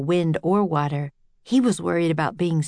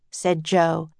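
usual speaking voice

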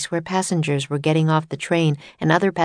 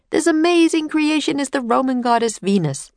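tour guide announcer; 9 semitones higher than usual. Acted. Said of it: "said the tour guide".

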